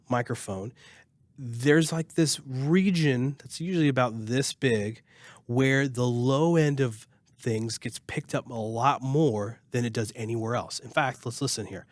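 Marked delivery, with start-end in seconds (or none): none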